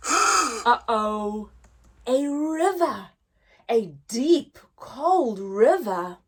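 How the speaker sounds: lively, sing-song picture-book reading; reading to children. Acted. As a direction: read it big for little listeners. Teacher reads the bear hunt story.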